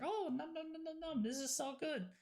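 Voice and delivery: high, soft nom-nom voice; mock gobbling. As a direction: play eating something delicious, high and light. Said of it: "and then it's like".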